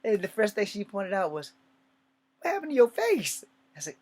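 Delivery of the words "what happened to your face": alarmed aunt. Retold or imitated. Aunt alarmed at his face.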